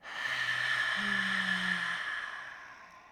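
long loud out-breath through the mouth; audible breathing. Shown as a demonstration. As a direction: let the breath out with a big sigh. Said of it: "That's gonna sound like this".

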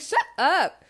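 playful retort. Retold or imitated. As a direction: bat away the teasing after laughing. Retort repeated after her laughing.

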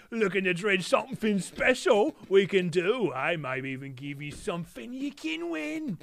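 put-on Cockney accent. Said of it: "I nailed his voice".

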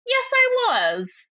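hammed-up sadistic glee; gloating relish. Reads narrator relishing sadistic revenge.

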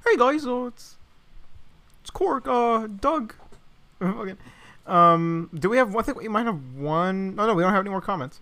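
hammy Kermit-style host; Korg voice. Acted. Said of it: "Kermit the Frog impression".

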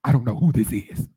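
mimicked loud aside off the phone; Margaret Washington. Colleague covering the receiver, imitated loud aside. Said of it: "cover the thing and be like".

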